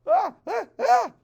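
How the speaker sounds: high yelps of burning pain. Acted. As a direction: yelp as fur catches fire. Fur catches fire.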